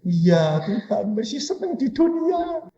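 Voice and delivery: soft, rising plaintive reply; small high voice, pleading in mockery; mock reluctant believer. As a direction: mimic a sheepish plea to God. Acts reluctant believer answering God.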